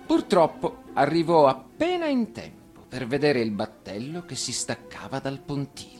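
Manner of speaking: usual speaking voice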